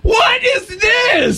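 high, slow, mock crying wail; mock sobbing.

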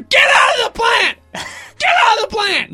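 high loud scolding yell; shouting at cat. Retold or imitated. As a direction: chase the cat out, yelling.

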